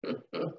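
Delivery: small, soft mock chuckle; quiet sly laugh. Woman after hanging up the phone, quiet private laugh. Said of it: "in her little quiet head, she laughs".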